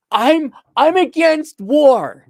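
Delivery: high, loud, drawn-out mock protester; mock a self-righteous pacifist, slow and loud; exaggerated slow, high-pitched idiot pacifist. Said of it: "the idiot pacifist".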